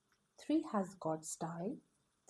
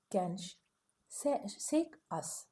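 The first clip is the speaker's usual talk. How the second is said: usual speaking voice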